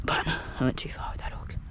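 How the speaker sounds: whispered under the breath; whispered aside. Real, not acted. Angler rigging a hook, whispering to himself. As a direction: whisper it to yourself while fiddling.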